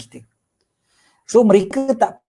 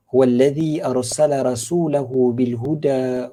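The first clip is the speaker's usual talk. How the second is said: slow, low Arabic recitation; Quranic recitation; 3 semitones lower, slower than usual. Acted. Preacher recites a verse from Surah As-Saff, slow recitation. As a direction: recite the verse slowly, drawing out syllables.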